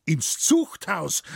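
slow, high, indignant echo; drawn-out indignant exclamation; calling out. A narrator's reading. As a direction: throw it back at him, outraged. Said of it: "rief der alte Schränk".

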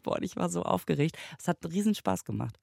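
ordinary delivery

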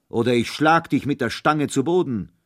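loud threatening roar; booming thunder voice. A narrator's reading. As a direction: bellow the threat. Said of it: "mit donnernder Stimme". (drohend donnernd)

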